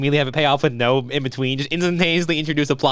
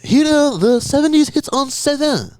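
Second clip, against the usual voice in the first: radio DJ voice; 11 semitones higher, slower than usual. Acted. Mock radio DJ announcement.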